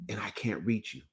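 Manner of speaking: soft whispered confession. Real, not acted. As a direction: whisper it close. Coach's intimate aside.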